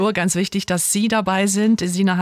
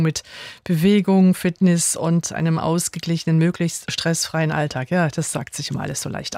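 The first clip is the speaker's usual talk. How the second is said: ordinary talk, nothing marked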